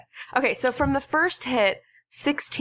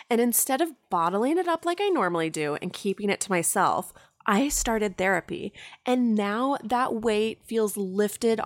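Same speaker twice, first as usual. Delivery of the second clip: friendly sponsor read. Acted. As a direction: read it like a host ad. Host reads a sponsor ad.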